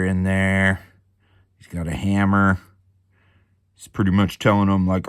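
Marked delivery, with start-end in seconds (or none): none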